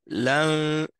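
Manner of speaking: "L'in-" said as one long hummed lan; la fused into noun. Shown as a demonstration. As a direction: glide from L straight into a held N on "L'in-". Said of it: "il se fusionne avec le noun directement".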